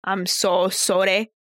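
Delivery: low, slow Scottish impression; Peter Quint. Co-host runs through show impressions, silly Scottish accent. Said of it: "we already got Peter Quint".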